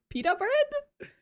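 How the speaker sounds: way up high, slow pita bread; high squeaky question. Real, not acted. Streamer mocking chat's Peter, squeaky high.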